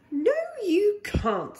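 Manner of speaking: gently but firmly say no as Mum; mother bear refusing. Acted.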